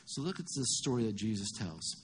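ordinary talk

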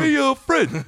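high goofy cartoon neighbor; Barney voice. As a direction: pitch it way up, buddy-buddy. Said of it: "Barney's like".

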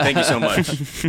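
high, slow moderator send-off; lightly hammed-up announcer thanks; mock sign-off. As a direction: wrap it up with exaggerated formality. Signs off as mock debate moderator.

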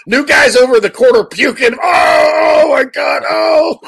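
mock panicked shouting, way up high; hammed-up screaming wail; quoting a movie wail. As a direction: yell and wail like a rookie puking in the corner. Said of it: "it's like".

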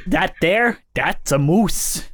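put-on Minnesotan accent. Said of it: "My Newfoundland accent ... turns into Minnesotan".

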